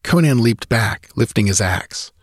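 usual speaking voice

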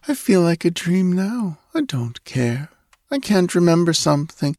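high, dreamy half-asleep voice; sleepy girl. A narrator's reading. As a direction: drift off as you speak. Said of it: "she yawned".

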